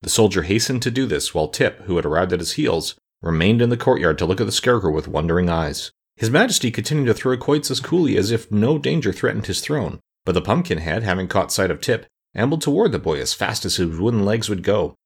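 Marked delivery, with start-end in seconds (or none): none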